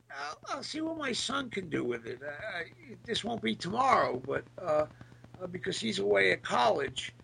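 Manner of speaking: ordinary delivery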